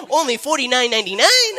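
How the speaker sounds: high, loud cartoonish yell; friend's voice. Imitating a friend thrilled by a good deal, hammed-up high shout. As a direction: shout it like an over-excited bargain hunter. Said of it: "That's how my friend talks".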